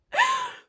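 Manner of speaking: short high burst of laughter; real laugh. Real, not acted. Storyteller amused by her own "hey, clown", laughing. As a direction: break off into a sudden laugh.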